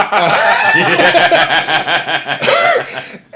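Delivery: loud, long cackle; real laugh. Real, not acted. Teller amused by his own retelling, in a loud cackle. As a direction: burst into helpless laughter.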